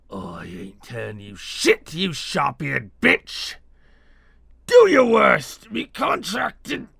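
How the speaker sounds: strained, high, slow defiant yell; defiant shout. Acted. Wounded captive refusing to talk to his torturer, defiant shout.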